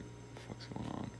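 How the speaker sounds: whispered, spooked. Retold or imitated. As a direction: breathe it out in a scared whisper. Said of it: "I was like".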